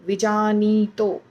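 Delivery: विजानीतो said slowly with a long नी; slow drawn ee. Shown as a demonstration. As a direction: stretch the नी in विजानीतो. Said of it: "व के पहले छोटी ई है".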